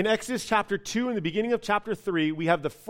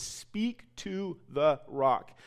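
a hint of God speaking, slow and hushed; slow, soft voice of God; 2 semitones lower, 5 dB quieter, much slower than usual. God quietly telling Moses to speak to the rock, slow and hushed. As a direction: say it slowly and softly, like a quiet divine command. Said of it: "He says".

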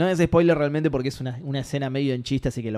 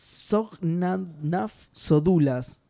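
slow, careful deciphering; puzzling out an unreadable name; sounding it out; 3 semitones higher, much slower than usual. Real, not acted. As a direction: read strange words haltingly, very slowly. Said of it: "es más jodido de leer".

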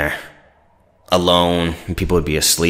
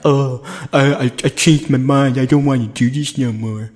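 whiny recruit; 8 semitones higher than usual. Retold or imitated. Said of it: "you're like".

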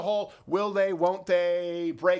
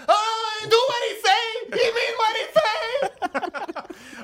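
much higher, louder hammed-up shout; mock outraged shout; 13 semitones higher, 6 dB louder, slower than usual. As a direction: yell it like an indignant president. Mocking Mexican president's reaction.

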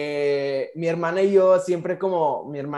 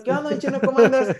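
excited greeting; 7 semitones higher, 6 dB louder, faster than usual. Retold or imitated. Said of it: "grabé con ese, con ese señor".

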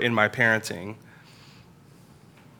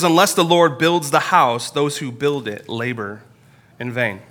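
scripture reading; 2 semitones higher, 3 dB louder than usual. Acted. Pastor quotes verse one.

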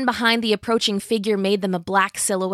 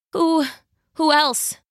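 put-on dazed girl; 7 semitones higher, slower than usual. A narrator's reading. Dazed Jill voiced higher.

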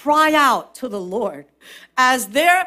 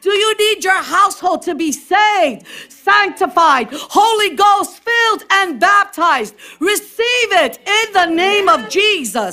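full-voiced sermon proclamation, higher pitch; preach; 9 semitones higher, 4 dB louder than usual. Real, not acted. Preacher urging the congregation to receive it, preaching at full force. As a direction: call out the promises with force.

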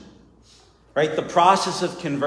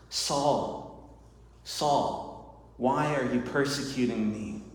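soft, hushed divine call; quiet voice of Jesus; 6 dB quieter, slower than usual. Acted. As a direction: call his name gently, almost from afar. Voice of Jesus in sermon.

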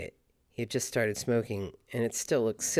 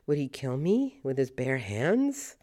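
ordinary talk

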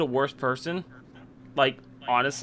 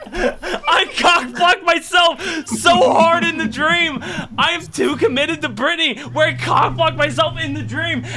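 high, loud words through laughter; laughing shout; 12 semitones higher, 7 dB louder than usual. Real, not acted. Host cracking up over his dream story, laughing loudly. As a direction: half-shout it while still laughing.

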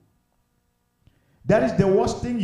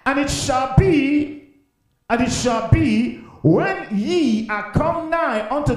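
high, slow, declaimed Bible reading; stretched-out pulpit reading; scripture proclamation; 6 semitones higher, 4 dB louder, slower than usual. Real, not acted. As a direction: proclaim the verse slowly to the congregation. Reads scripture aloud.

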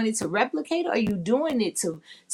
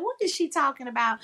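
mock puzzled; 8 semitones higher, faster than usual. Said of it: "I know you was like".

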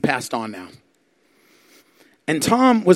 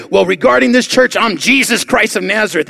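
loud and raised, proclaiming; bold declaration; 6 semitones higher, 7 dB louder than usual. Retold or imitated. Old man telling the witches who he is, loud bold declaration. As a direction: announce it with full authority. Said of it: "old man answers witches".

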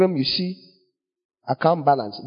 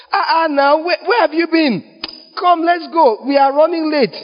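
much higher, hurrying someone along; urgent mock exasperation; 12 semitones higher, 4 dB louder than usual. Companion covering for someone walking with another, high-pitched urgent scolding. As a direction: call out as if fed up with waiting.

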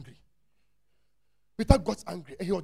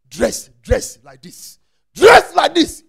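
rising shout, dress like this, much louder; 9 semitones higher, 21 dB louder, much slower than usual. Acted. Preacher acting out the woman seizing the dress, rising shout. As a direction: grab at it and shout it out.

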